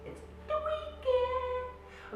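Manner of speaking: high, drawn-out singsong; singsong announcement. Real, not acted. Host greeting listeners at week's end, high singsong. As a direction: sing out the good news, high and slow.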